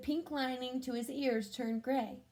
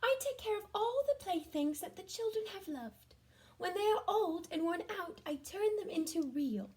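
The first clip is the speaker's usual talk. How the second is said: fairy voice; 8 semitones higher than usual. Acted. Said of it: "she said".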